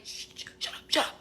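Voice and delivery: whispered shh, shut up; shushing whisper. Retold or imitated. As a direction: hush her in a whisper. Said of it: "He's like".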